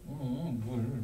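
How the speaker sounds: put-on 물 said in soft, babyish lisping tones; lisping baby talk. Shown as a demonstration. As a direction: say 음, 물 as cute baby talk with a short tongue. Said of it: "약간 이런 느낌이잖아요". (혀 짧은 소리)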